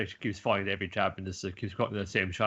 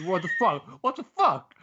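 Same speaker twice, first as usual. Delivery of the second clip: loud disbelief climbing from high to much higher; incredulous yell; 8 semitones higher, 6 dB louder than usual. Shown as a demonstration. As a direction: shout it in disbelief, going up each time. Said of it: "You need to have that kind of, like, inflection".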